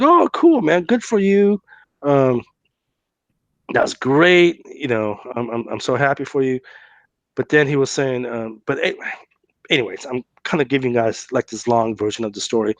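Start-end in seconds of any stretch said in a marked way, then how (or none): 0.0-6.6 mock gushing. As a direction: overdo the happy-for-you cheer. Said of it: "I'm like".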